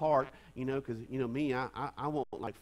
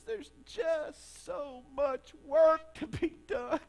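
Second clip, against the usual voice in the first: put-on high, very slow, loud child's wail; crying little girl; 10 semitones higher, 6 dB louder, much slower than usual. Pastor voicing the sobbing girl complaining, high slow wail. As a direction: wail it out like an overworked toddler. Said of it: "She says".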